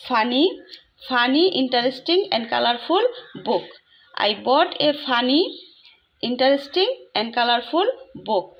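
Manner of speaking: very slow, word by word, for students to copy; slow dictation. Shown as a demonstration. Teacher to students, dictating the sentence very slowly. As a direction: spell it out slowly like dictating to a class.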